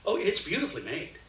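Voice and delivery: ordinary delivery, nothing marked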